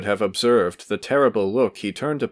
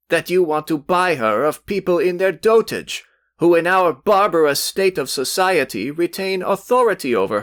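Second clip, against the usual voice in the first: hot indignation; 7 semitones higher than usual. A narrator's reading. Youth berates his rival before Velchaninov, rising heated indignation. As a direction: build a young man's heated tirade. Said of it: "turning hotly to Velchaninov".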